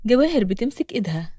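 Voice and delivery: ordinary delivery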